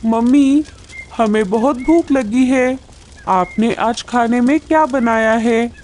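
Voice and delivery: high-pitched little chick voice; hungry chick. Acted. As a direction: plead to Mummy like a hungry child. Hungry chicks speak to mother. (भूखे चूज़े की ऊँची आवाज़)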